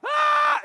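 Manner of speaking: high, loud mock scream; crowd scream. Excited crowd rushes into the concert, screaming. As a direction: burst out a high-pitched yell. Crowd rushing in when doors open.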